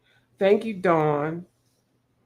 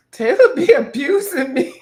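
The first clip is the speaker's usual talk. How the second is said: laughing; 6 semitones higher, 8 dB louder than usual. Real, not acted. Joking complaint through laughter.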